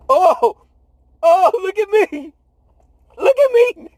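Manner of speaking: high, gleeful bragging; hammed-up boastful Peter; showing off. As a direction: brag like a kid showing off. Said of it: "He like".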